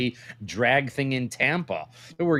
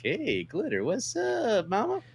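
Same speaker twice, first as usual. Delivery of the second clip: sliding way up high on mama, flirty catcall; flirty high-pitched hey mama; pickup line voice; 11 semitones higher than usual. Pretend. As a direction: call out to her like a smooth street hustler. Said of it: "and then you're like".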